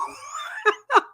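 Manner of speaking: laugh. Real, not acted. Laughing at her own story.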